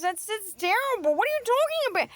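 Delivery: hammed-up shocked voice; mock outrage. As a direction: play a scandalized scold. Mock outrage at dog claim.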